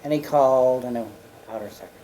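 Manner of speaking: usual speaking voice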